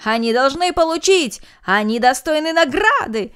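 loud high crowd cry; loud crowd demanding a reward; shouting. A narrator's reading. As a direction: cheer them on loudly. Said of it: "закричали все без исключения".

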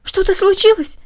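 high, loud frightened question; alarmed question. Acted. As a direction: ask in sudden alarm. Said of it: "воскликнула Маша".

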